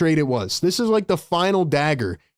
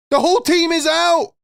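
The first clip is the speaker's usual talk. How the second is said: higher, louder, shouted "The whole team is out"; angry shout; 9 semitones higher, 3 dB louder than usual. Real, not acted. Streamer shouting at chat that the team is out. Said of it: "Sorry for the crash out".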